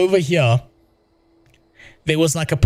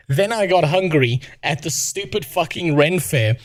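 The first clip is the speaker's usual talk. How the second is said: ordinary delivery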